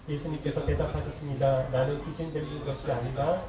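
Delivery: low, loud, even read-aloud voice; scripture recitation. Acted. Reads scripture aloud with congregation. (성경을 낮고 힘 있게 낭독)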